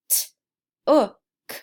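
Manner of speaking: "T-o-k" split into sounds; sounding out letters. Shown as a demonstration. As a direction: sound out "T-o-k" piece by piece. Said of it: "Let's look at the word took".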